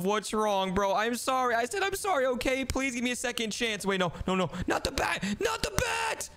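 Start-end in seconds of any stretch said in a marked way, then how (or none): 4.7-5.2 higher, frightened plea; alarmed plea. Acted. Elf threatens with a bat. 5.4-6.3 very high, shouted plea; terrified shout. Acted. Elf threatens with a bat.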